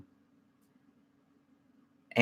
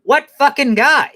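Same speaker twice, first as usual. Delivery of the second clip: loud, high, drawn-out mock outrage; Mad Max; 13 semitones higher, 5 dB louder than usual. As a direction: imitate an irate husband demanding answers. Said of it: "that dude get all mad and shit".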